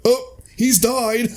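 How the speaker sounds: high, slow, drawn-out gasp of dismay; a guest lamenting the father's death, high and slow; mock dismay. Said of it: "he hears someone like".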